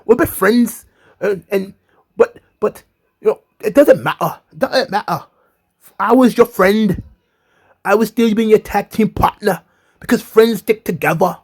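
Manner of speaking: high pleading Nikki voice; imitating Nikki. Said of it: "and Nikki's like".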